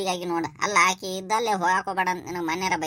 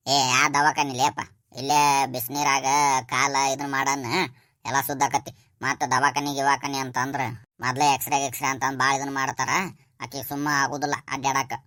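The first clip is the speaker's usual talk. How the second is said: lower, dismissive home-remedy talk; lower-voiced elder; 6 semitones lower than usual. Elder tells the boy to skip the hospital, lower dismissive voice. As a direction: brush off the hospital, just soak it in hot water. Another figure, much lower voice.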